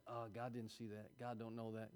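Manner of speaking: low, very soft aside to oneself; hushed self-excusing. Pretend. Person thinking they get by with things, low hushed aside. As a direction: murmur it as a sneaky thought. Voicing one's sneaky inner thought.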